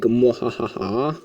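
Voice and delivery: slow drawn-out villain cackle; evil laugh. Pretend. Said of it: "I'm evil".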